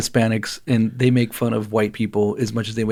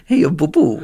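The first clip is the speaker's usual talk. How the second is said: put-on Japanese accent; 4 semitones higher than usual. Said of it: "in a Japanese accent".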